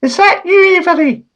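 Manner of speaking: very high falsetto wife; wife's voice. Pretend. Wife sniffing him during a kiss, high falsetto. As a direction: squeak it up high like the suspicious wife. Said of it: "and says".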